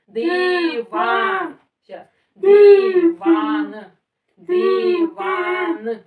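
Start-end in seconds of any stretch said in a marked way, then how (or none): 0.1-1.6 loud demo. Shown as a demonstration. Teacher shows the child how to read it, loud and drawn out. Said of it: "Дивись у мене". 2.3-3.8 loud demo. Shown as a demonstration. Teacher repeats the word for the child, loud and drawn out. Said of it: "А громко надо казати". 4.4-6.0 loud demo. Shown as a demonstration. Teacher shows the child how to read it, loud and drawn out. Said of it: "А громко надо казати".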